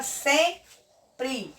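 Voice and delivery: "sempre" slowly broken into sem, pre; split into syllables. Shown as a demonstration. Teacher shows pupils the syllables, "sempre" split slowly. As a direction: say "sempre" in two separate syllables with a pause between. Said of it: "Onde é que fica a sílaba forte?".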